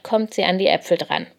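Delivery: ordinary delivery, nothing marked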